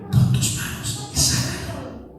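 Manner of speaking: high hushed mock voice of God; whispered divine command; voice of the Lord. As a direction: speak the Lord's command softly, from above. The Lord's command, quoted.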